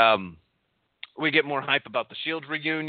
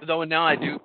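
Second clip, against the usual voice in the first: normal talking voice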